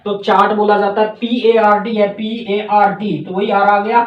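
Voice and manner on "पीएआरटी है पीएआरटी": पीएआरटी spelled letter by letter, slowly; spelling out. Shown as a demonstration. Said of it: "आर के बाद consonant आए तो आर silent". (पीएआरटी को अक्षर-अक्षर धीरे से बोलें)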